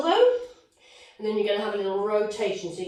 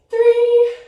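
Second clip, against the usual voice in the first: high loud strained count; straining coach count; 12 semitones higher, 12 dB louder than usual. Real, not acted. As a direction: push the count out hard at the end. Coach counting down final reps.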